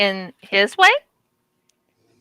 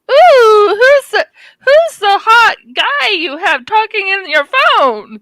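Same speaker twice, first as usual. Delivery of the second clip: high, loud gushing over a mystery man; swooning caller; 11 semitones higher, 5 dB louder, slower than usual. Acted. Woman imitating callers hearing the voicemail, swooning. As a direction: squeal it, then draw the question out. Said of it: "they'll be like".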